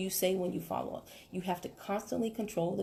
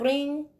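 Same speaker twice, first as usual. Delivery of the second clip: voiced "Ring" a bit higher and louder; phone ring; 6 semitones higher, 5 dB louder than usual. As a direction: play the phone ringing. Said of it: "You ring".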